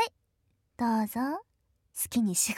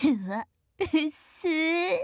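disgusted; 3 semitones higher, much slower than usual. Acted. Sister disgusted at result.

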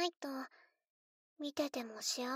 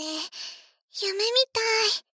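dreamy delight; 6 semitones higher, 8 dB louder, much slower than usual. Acted. Dreamy delight over her doll.

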